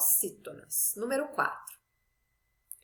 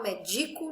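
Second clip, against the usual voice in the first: "médico" wrongly stressed on the middle syllable, me-DI-co; misplaced middle stress; 2 semitones lower, 4 dB quieter than usual. Shown as a demonstration. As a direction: shift the beat in "médico" onto DI. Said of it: "eu não falo médico nem médico".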